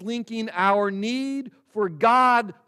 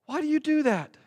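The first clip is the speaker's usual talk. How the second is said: youth asking; 10 semitones higher than usual. Retold or imitated. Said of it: "they've noticed it too".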